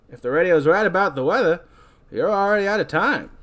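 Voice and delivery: ferry captain character. Acted. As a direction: retort in a swinging captain's voice. Captain answering back.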